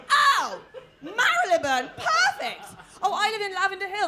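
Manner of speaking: loud, high mock-impressed gushing; mocking posh awe. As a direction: gush over it in sarcasm. Mocking the Marylebone boast.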